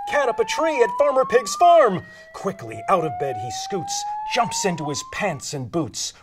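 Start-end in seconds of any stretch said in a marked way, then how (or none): 0.1-2.0 high, loud emergency announcement; raised, urgent storybook alarm; urgent alarm call. Acted.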